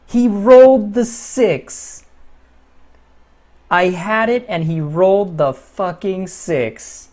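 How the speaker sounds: loud high yelling at bad luck; real shouting disbelief at the roll; furious outburst. Real, not acted. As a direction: rage at the dice, slowly. Real dice roll outrage.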